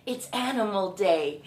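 ordinary delivery

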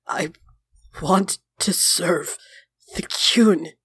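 halting words through bleeding lips; very slow, pained. A narrator's reading. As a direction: labour slowly over each word, pausing between. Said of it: "very slowly says through bleeding lips".

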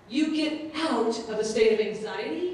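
ordinary talk, nothing marked

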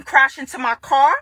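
normal talking voice

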